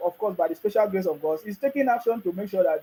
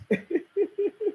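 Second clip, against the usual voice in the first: high, wheezy run of laughter; short high giggles; derisive chuckle; 10 semitones higher, 4 dB quieter than usual. Real, not acted. Laughs at those making noise.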